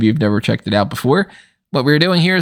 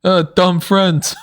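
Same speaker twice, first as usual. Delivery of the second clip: slow, higher, dopey monster growl; imitating the shark; 6 semitones higher, much slower than usual. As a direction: drawl it out like a dim giant shark. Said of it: "his best line of the movie".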